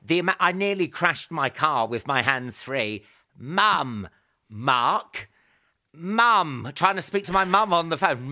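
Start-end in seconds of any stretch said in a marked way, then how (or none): 3.4-6.7 firm voice-command call; names called out separately, with a gap after each. Retold or imitated. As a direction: call one name at a time to the hands-free. Calling names into hands-free phone.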